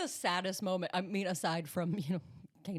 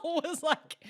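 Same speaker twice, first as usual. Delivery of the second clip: ordinary delivery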